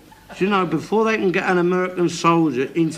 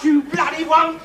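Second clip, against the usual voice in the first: high shouted insult; high angry yell; 7 semitones higher than usual. Real, not acted. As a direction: spit it out at her. Shouting at daughter in row.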